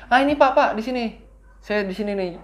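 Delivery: put-on a lost kid suddenly spotting home; excited recognition. Child spots home, calls driver.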